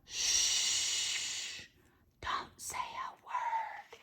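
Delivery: soft hushed whisper. Acted. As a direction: whisper like a quiet mouse. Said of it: "Quiet as a mouse now".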